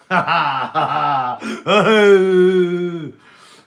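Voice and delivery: bursts into a long, loud laugh; a howling real laugh; big loud laugh. Real, not acted. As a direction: crack up hard at your own joke. Laughing at own pun.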